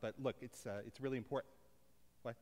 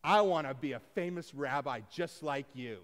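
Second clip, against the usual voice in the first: lightly acted kid appealing to dad, louder; eager ten-year-old; 2 semitones higher, 7 dB louder than usual. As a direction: announce it proudly like a hopeful child. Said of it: "went up to him and I said".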